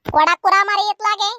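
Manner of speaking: loud, forceful order; heated quarrelling shout; angry demand. Acted. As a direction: demand it back from a thief. Demands stolen lamb back in quarrel.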